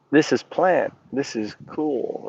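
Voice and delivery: soft, slowing murmur of discovery; quiet retold wonder; hushed realization. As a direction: say it softly, as if just realizing it. Retelling a quiet moment of realization.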